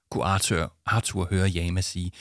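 plain reading voice

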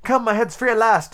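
high-pitched cry of delight. Acted. Alice freed at last, high and delighted. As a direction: raise the pitch with happy relief. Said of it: "said Alice in a tone of delight".

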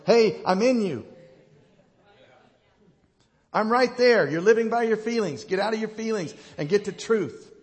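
acted loud, high voice cutting in; emphatic rebuttal. God answering a lonely prayer, loud and insistent.

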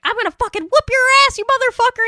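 hammed-up furious threat; mother threatening Satan, mock rage.

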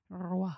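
ROI with a practised rolled R; rolled French R. Shown as a demonstration. Struggling to pronounce French, rolled R in Roi. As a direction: roll the R at the start of "Roi". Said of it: "I was practicing rolling my R's".

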